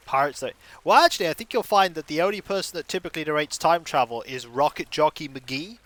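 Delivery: usual speaking voice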